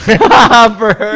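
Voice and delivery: loud, high, laughing repeat; real laughter bursting through the words; laughing echo. Real, not acted. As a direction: crack up while echoing the punchline. Laughing echo of co-host's punchline.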